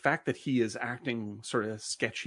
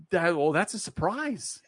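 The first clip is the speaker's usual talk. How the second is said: slow, higher-pitched stunned reaction; imitated shock; 5 semitones higher, 4 dB louder than usual. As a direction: take it in slowly, acting taken aback. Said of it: "She's totally fucking shocked".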